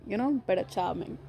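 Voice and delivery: ordinary delivery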